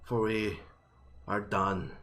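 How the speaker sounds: defiant dog folk. Acted. Nagual dog folk confronts the torch-bearing performers, defiant. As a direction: confront the beasts with weary anger.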